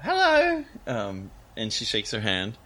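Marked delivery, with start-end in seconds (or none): 0.0-0.7 very high timid hello; Esther's voice. Acted. Esther greets Dolly, pitched way up and shy. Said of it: "Esther goes".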